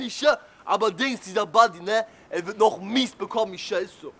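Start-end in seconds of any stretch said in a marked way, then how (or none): none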